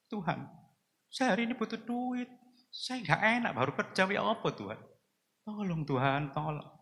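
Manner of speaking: high, soft pleading prayer; tearful prayer. Retold or imitated. Preacher reliving his prayer while crying, high and soft. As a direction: beg God softly, close to tears. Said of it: "Saya nangis".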